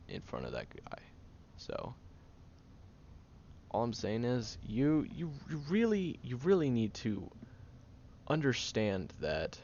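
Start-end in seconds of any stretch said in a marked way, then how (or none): 4.7-6.9 higher, pressing repeated "you really"; urgent insistence. Real, not acted. Caretaker scolding sick friend, high and urgent. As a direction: push hard on "really", stumbling to insist.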